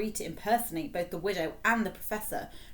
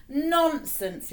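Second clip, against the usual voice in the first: brisk, higher, louder bracing tone; 9 semitones higher, 7 dB louder than usual. A narrator's reading. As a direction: snap him out of his fear. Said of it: "said Spittleworth bracingly".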